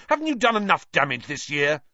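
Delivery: loud, scolding outrage; furious. A narrator's reading. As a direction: throw the question as an angry accusation. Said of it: "said Ron furiously".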